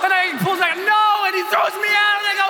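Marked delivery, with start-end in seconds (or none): 0.8-1.2 high, loud panicked no; terrified no. Retold or imitated. Jumper refusing as instructor shoves him out, high panicked no. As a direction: protest in terror. Pulled back, thrown out.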